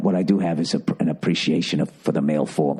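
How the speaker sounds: put-on deep, halting British old man; bad British accent. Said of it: "I can't keep doing this accent".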